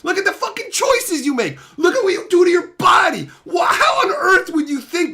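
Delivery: yelling, half laughing in disbelief; furious yelling with a laugh. Real, not acted. Streamer yelling at a viewer, laughing in disbelief. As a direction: shout in exasperated disbelief. Rant escalates.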